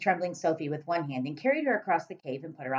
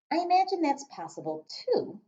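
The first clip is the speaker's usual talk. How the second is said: put-on little girl voice; 12 semitones higher than usual. Sophie's voice.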